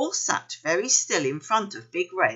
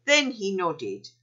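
ordinary delivery, nothing marked

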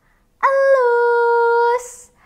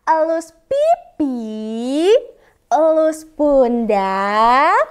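slow soft; 8 semitones lower, much slower than usual. Shown as a demonstration. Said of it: "gerakan pelan-pelan".

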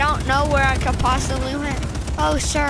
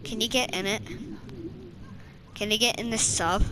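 ordinary talk

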